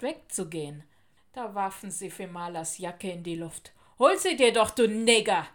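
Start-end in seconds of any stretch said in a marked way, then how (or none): 4.0-5.5 loud, raised jeer; taunting shout. A narrator's reading. Bullies taunt girl over her jacket, shouting. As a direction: goad the victim with a loud call. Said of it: "schrien sie und lachten".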